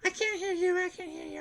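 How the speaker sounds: high falsetto mocking "I can't hear you"; sing-song taunt in her high voice; mocking yell. Homeless woman taunting the guard, high falsetto mock yell. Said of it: "She started yelling".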